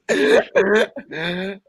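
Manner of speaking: a long high giggle; high rising laugh. Real, not acted.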